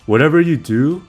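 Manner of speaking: ordinary talk, nothing marked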